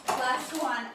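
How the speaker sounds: loud coaching countdown. Real, not acted. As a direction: push them through the last reps. Instructor counting down the final reps.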